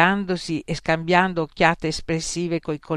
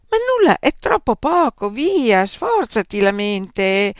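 exasperated; 7 semitones higher, 7 dB louder than usual. Acted. Examiner losing patience.

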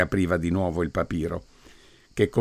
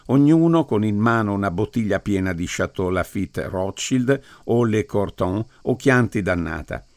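ordinary delivery

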